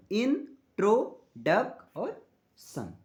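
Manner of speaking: "in, ट्रो, डक और सन" slowly with gaps between syllables; syllable split. Shown as a demonstration. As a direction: count out in, ट्रो, डक, सन as four separate beats. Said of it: "Introduction has four syllable".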